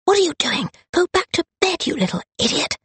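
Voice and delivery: high, sharp, irritated scolding; scolding sister. Acted. Charlotte scolding Rosalind to go back to bed, annoyed. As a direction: shoo the little sister away.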